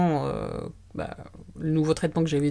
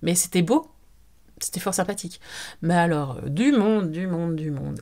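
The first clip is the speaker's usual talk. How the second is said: ordinary delivery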